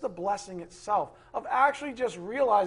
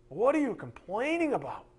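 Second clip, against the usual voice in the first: ordinary delivery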